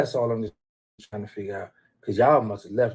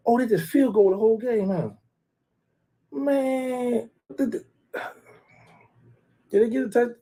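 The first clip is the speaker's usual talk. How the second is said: mocking disbelief; 11 semitones higher than usual. Real, not acted. Taunting the losing team's scoring.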